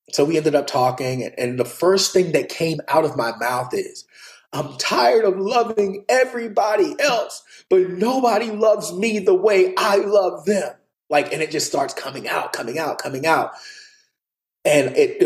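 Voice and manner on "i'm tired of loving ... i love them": higher, slow, breaking voice; a hint of a little boy pleading; tearful confession. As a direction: let it spill out like a hurt child. Confession while crying to mom.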